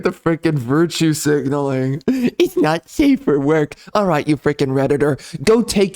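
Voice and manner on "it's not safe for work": mock prissy voice. Mocking a commenter's prim complaint.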